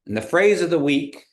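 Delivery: ordinary talk, nothing marked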